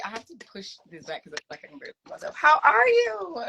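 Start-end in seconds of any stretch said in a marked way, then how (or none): 2.4-3.4 high, loud, drawn-out greeting; excited greeting. Real, not acted. Host welcoming a housemate to the chat, excited greeting.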